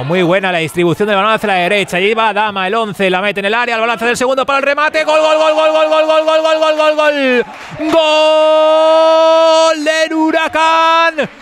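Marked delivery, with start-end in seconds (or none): 5.0-11.3 goal call. Real, not acted. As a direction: stretch out every gol at full voice. Goal scored, live commentary.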